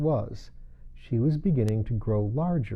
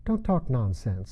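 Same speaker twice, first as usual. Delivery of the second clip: ordinary delivery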